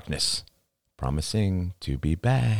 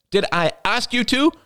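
very high-pitched, hurt retort; indignant cry; 4 dB louder than usual. A narrator's reading. Girlfriend crying back at him after he snaps, indignant. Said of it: "she cried".

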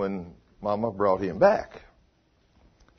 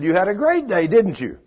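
higher and louder, beaming certainty; confident, delighted; 7 semitones higher, 5 dB louder than usual. Retold or imitated. Husband tells wife she had a great day, high and delighted. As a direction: sure of the answer before asking.

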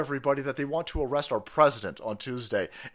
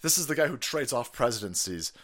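ordinary delivery

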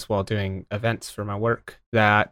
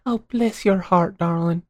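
higher, syrupy sweetness; mock sweet; 5 semitones higher, 4 dB quieter than usual. Speaker insulting someone with a sweet phrase, mock sweet. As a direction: coo it sweetly while meaning an insult. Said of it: "it sounds so sweet".

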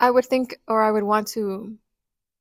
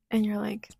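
ordinary talk

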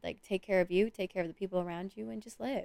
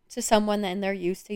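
ordinary talk, nothing marked

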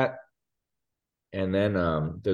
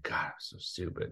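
muttered self-annoyance; 2 semitones lower, 12 dB quieter than usual. Retold or imitated. Dad driving back for forgotten wallet, pissed at himself. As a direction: curse yourself under your breath. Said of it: "I'm just, like, pissed".

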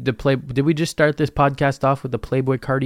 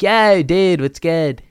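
higher, louder laid-back bro greeting; 5 semitones higher, 4 dB louder, slower than usual. Pretend. Podcaster imagining greeting a stranger, mock bro voice. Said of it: "what if I talked like that?".

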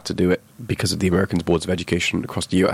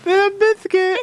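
squeaky voice; 5 dB louder, slower than usual. Pretend. Squeaky repeat.

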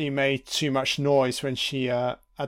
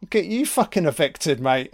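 higher, louder sly taunting; mocking Carter; 5 semitones higher, 4 dB louder than usual. Viewer voices Carter goading the landlord, higher mock taunt. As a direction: goad him while he hits you. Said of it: "Carter's like".

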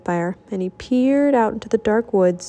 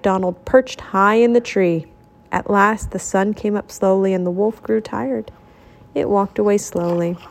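usual speaking voice

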